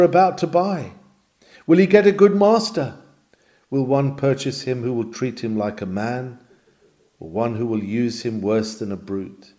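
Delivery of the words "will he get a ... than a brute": ordinary talk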